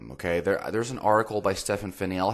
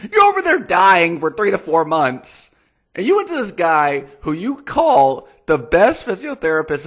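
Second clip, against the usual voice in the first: loud, high-pitched exasperation; incredulous rant; 10 semitones higher, 8 dB louder than usual. Real, not acted. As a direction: rant in raised-voice disbelief. Exasperated rant about the misdiagnosis.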